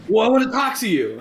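panicked caller imitated; mock whine. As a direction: play the caller begging, squeaky and loud.

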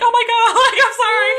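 high, loud laughing "oh my God"; high laughing gasp of apology. Retold or imitated. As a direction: laugh it out, embarrassed. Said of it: "I was like".

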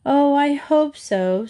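hope aloud, wearily and without conviction; sighed. A narrator's reading. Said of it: "Sue sighed".

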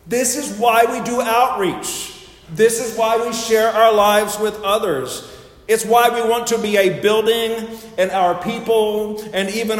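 high, loud, emphatic pulpit delivery; preaching. Real, not acted. Preacher tells the congregation why the church is open, forceful preaching. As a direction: drive the point home to the back pew. Preacher drives home main point.

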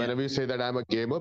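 normal talking voice

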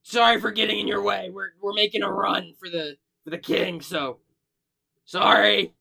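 high, loud, quick squeaky kobold apology; a little creature babbling sorry in a rush; squeaky nervous kobold voice; apologetic kobold. Acted. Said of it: "Kobold goes".

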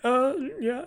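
high, far, flustered uh yeah; high flustered reply; awkward Doctor. Retold or imitated. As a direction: squeak it out like a kissed man caught off guard. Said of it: "he's like".

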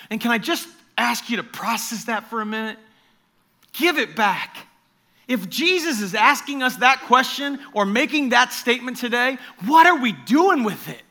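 fervent preaching. Real, not acted. Preacher challenging the church, fervent and loud. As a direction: press the congregation hard.

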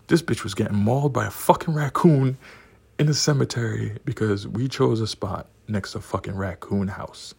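ordinary delivery

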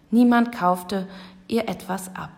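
ordinary delivery, nothing marked

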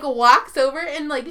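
normal talking voice

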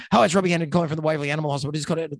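very fast, rattled-off phone greeting; rushed phone intro. Retold or imitated. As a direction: gabble it like a busy vet. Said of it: "you do the same thing when you ring up people".

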